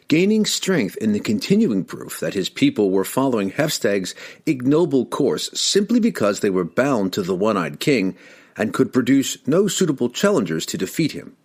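usual speaking voice

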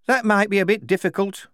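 a woman recruit answering back; female soldier. Acted. Squad women talk back.

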